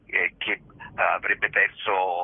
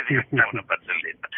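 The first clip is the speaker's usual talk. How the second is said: quick words bubbling through a laugh; 4 semitones higher, faster than usual. Real, not acted. To the interviewer, mocking a staging that became a joke, laughing. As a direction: laugh it out, amused. Amused about a staging gone comic.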